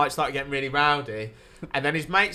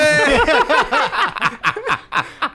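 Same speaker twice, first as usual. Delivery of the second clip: big laugh; 12 semitones higher, 9 dB louder than usual. Real, not acted. Laughing after the biting story.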